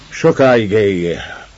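growled toast. Acted.

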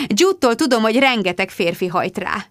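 ordinary delivery, nothing marked

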